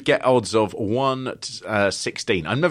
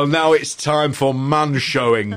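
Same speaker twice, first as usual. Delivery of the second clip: loud, high caveman-ish bravado; Kelvin impression voice; 4 semitones higher, 5 dB louder than usual. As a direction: boast it like a dim, cocky dancer. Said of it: "my best Kelvin impression".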